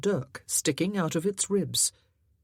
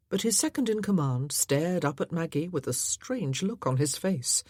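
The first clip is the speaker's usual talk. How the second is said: ordinary delivery, nothing marked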